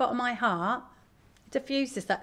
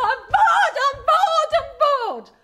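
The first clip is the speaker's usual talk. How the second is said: put-on high, loud childish whine of I'm bored; hammed-up bored child, much higher and louder; silly whine; 12 dB louder than usual. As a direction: whine like a bored kid jumping up and down. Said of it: "I would jump up and down and go".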